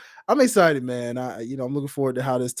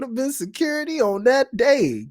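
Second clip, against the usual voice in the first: very high-pitched hyped wish; excited gushing; 12 semitones higher than usual. Retold or imitated. Podcaster wishing he had worked that brawl, excited.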